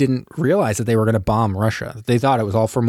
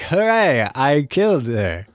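mock cheer; 4 semitones higher, slower than usual. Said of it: "she's like".

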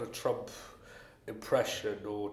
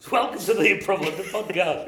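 hammed-up, louder host welcome; podcast host intro; 9 semitones higher, 10 dB louder than usual. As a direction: announce it like a radio host. Restarting the show intro, louder.

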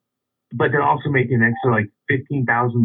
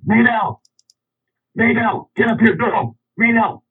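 high yelling call for Maybell; 13 semitones higher, slower than usual. Retold or imitated. Man in boxers yelling for his daughter, high-pitched holler. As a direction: holler for the girl from the doorway.

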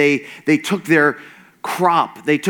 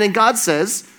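ordinary talk

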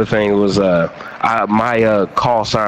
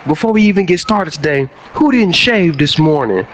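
drill sergeant voice; 6 semitones higher than usual. Retold or imitated. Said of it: "he was so drill sergeant".

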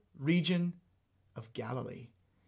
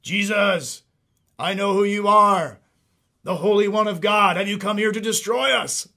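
demon's cry; 3 semitones higher, 9 dB louder than usual. Acted. Demon interrupting Jesus in the synagogue, crying out loudly. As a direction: cry out in alarm like a cornered demon. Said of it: "This deep cry comes out".

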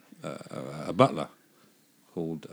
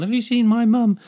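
put-on baby penguin voice; 12 semitones higher, 4 dB louder than usual. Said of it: "little baby penguin walks into a bar and says".

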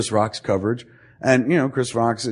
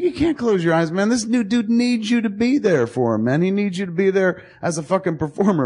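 high, insistent mock lecture; worked-up, exasperated retelling; retold telling-off; 10 semitones higher than usual. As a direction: plead and scold in a raised pitch. Retold lecture to sleeping actor.

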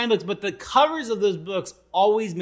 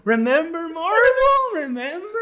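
high, louder, slow pleading remember; mock trailer pitch; 12 semitones higher, 7 dB louder, slower than usual. Friends mocking the Avengers theme trailers, high pleading voice. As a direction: tease the audience like a needy ad.

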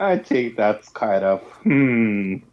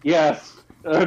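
ordinary talk

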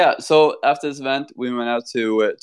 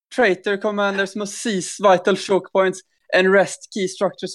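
higher, epic reading in a Norwegian accent; 7 semitones higher than usual. Shown as a demonstration. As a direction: voice Morten's dad's Norwegian accent. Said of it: "this will just be Morten's dad".

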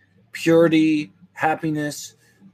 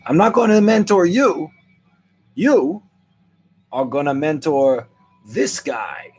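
emphatic; 5 semitones higher, 4 dB louder than usual. Acted. Loud emphatic declaration.

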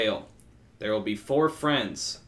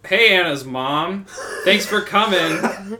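higher, louder, drawn-out hello; mock-cheery greeting; 6 semitones higher, 5 dB louder, slower than usual. Friend greeting Anna's mom who crashed dinner, mock-cheery. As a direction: greet with exaggerated, stretched-out politeness.

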